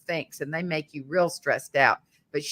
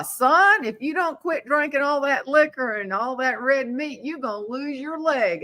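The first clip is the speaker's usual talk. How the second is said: scolding doctor; 6 semitones higher than usual. Retold or imitated. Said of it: "they say".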